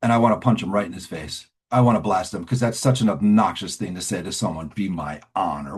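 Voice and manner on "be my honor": slow, low mock of smugness; mocking smug echo. Offended newcomer repeats the sponsor's reply, mockingly smug. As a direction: drag it out low with disdain. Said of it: "What a smug thing".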